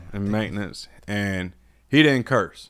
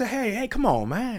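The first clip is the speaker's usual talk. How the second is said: soft, high-pitched imitation of an elder; smiling reproof; 11 semitones higher, 5 dB quieter than usual. Older master sergeant checking a younger soldier, imitated smiling reproof. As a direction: check him gently through a smile. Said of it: "the way he smiled with it".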